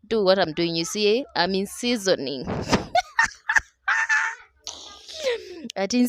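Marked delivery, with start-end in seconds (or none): 2.5-3.6 high, bright burst of real laughter. Real, not acted. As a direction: laugh at your own slip. Laughs at own English.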